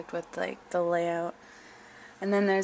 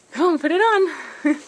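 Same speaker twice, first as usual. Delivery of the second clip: higher, louder sing-song coaxing; pet coaxing; 9 semitones higher, 8 dB louder than usual. Real, not acted. Owner coaxing her cat to wear a tiny hat, high excited pet voice.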